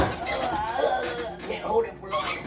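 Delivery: high, slow, strained from the weight; straining. Real, not acted. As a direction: squeeze it out while holding something heavy. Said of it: "It's too heavy".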